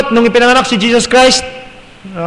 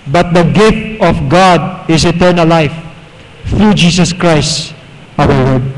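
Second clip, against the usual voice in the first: solemn scripture; 6 semitones lower, much slower than usual. Real, not acted.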